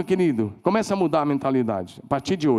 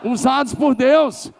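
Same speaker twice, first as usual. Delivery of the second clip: high, loud, drawn-out shout; shouted declaration; 10 semitones higher, 5 dB louder, slower than usual. Shown as a demonstration. Pastor leading the congregation in a shouted declaration. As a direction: shout it out, stretch the last words.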